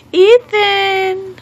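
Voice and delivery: high, drawn-out singsong name call. Real, not acted. Mother calling her baby, high drawn-out call.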